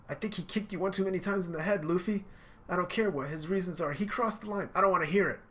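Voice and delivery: high, heated; angry. Retold or imitated. Nami angrily refusing Sanji's reasons. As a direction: refuse to listen, furious.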